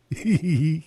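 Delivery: high little hee-hee giggle. A narrator's reading. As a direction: giggle mischievously from hiding. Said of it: "kicherte Ginny".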